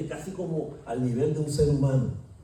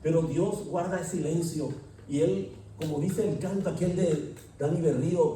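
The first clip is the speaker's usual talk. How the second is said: ordinary delivery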